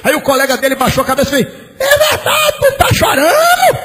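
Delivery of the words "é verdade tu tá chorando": high, teasing mock voice of a colleague; high mock voice of a colleague asking if he's crying; colleague's voice. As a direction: play the colleague poking fun, pitched way up. Colleague voiced in retold story.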